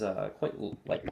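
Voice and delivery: normal talking voice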